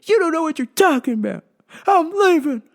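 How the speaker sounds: put-on high, loud bickering old man; husband voice. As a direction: scold your wife.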